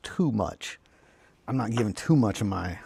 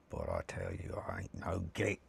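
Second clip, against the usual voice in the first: much lower, hushed Cockney orc; 14 semitones lower, 10 dB quieter than usual. Acted. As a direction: growl it low and quiet. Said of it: "why the accent?".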